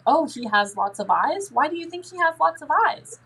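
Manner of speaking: curious parent, rising pitch. Shown as a demonstration. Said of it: "you're like".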